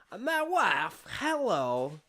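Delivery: high-pitched comic foreigner impression; hammed-up catchphrase impression; Borat. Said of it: "That's my Borat impression".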